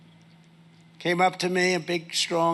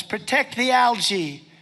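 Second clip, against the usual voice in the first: higher and slow, mocking; mocking sign quote; 6 semitones higher, 3 dB louder, slower than usual. Trump mocking algae protesters, slow sneer. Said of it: "people with signs".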